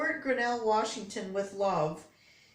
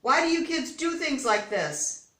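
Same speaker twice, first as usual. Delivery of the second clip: loud, higher furious scolding; angry yell; 5 semitones higher, 6 dB louder than usual. A narrator's reading. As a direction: tell the kids off at full volume. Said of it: "he yelled".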